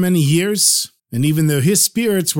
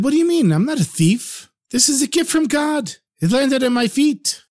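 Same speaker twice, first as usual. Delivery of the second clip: indignant husband; 10 semitones higher than usual. Acted. Husband defends himself to wife.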